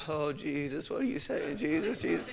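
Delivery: soft imitation of his own praying; little self-mocking plea, well under the voice; mock prayer murmur. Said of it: "I start doing my little".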